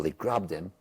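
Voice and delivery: ordinary delivery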